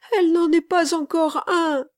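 put-on high, loud little boy's exclamation; voiced child's high, insistent cry. A narrator's reading. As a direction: protest as an upset small boy. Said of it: "s'écria l'enfant".

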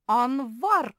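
very loud, high-pitched yell; yelled accusation. A narrator's reading. Мари yells her accusation of a thief, loudly. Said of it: "завопила Мари".

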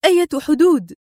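puzzled question. A narrator's reading. Woman looking around, asking which border, puzzled. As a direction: ask with pitch jumping up. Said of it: "تلفتت حولها متسائلة".

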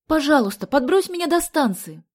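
high, loud pleading call; urgent shouted plea. A narrator's reading. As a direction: beg loudly for a quick lift. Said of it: "крикнула она".

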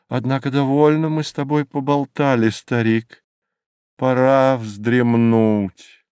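quick remark slowing into a drowsy close; a hint of character, winding down to sleep; lightly voiced mountain. A narrator's reading. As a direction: chat briskly, then stretch out the last words. Jungfrau ends the chat, proposes sleep.

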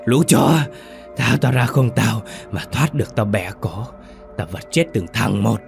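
possessed woman's snarled threats; furious cursing. A narrator's reading. Tied-up woman cursing the men restraining her, enraged snarl. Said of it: "miệng liên tục chửi rủa, đày nghiến mấy người".

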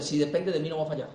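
low, hushed self-assurance; inner voice answering. Acted. Your inner voice answering the doubters, low and quiet. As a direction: drop low and soft, answering yourself. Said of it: "la voz te tiene que responder".

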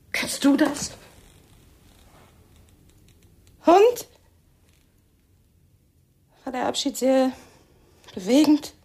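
loud, high, mocking jab; jealous, loud sarcasm. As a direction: taunt him about the farewell. Jealous wife confronts husband. (laut, hoch und höhnisch)

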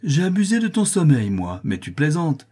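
high, loud mock disbelief; scoffing. A narrator's reading. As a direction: scoff at the accusation. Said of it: "Mon maître éclata de rire".

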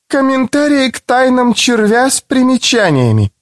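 put-on unsure young student; young woman voice. A narrator's reading. Ivetta reads title.

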